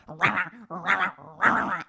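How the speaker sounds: little dog yap in a put-on voice; mock dog yapping. The little dog attacking Chloe's jeans, mock yapping. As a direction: yap like a tiny angry dog. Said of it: "So the dog is like".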